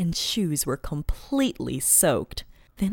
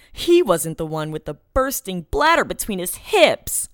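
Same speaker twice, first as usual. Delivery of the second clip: ordinary delivery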